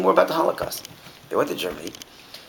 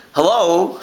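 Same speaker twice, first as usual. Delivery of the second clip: louder, higher "hello?"; mock exasperation; 7 semitones higher, 7 dB louder than usual. Teacher mocking the driver's missing the point. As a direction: wake him up.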